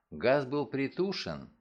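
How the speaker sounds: ordinary delivery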